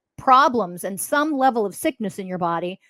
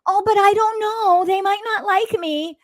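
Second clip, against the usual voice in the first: mock timid worrier; 12 semitones higher, 4 dB louder than usual. Warrior mocking those afraid of being disliked, high mock worry. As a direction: put on a high, fretful voice of a worrier.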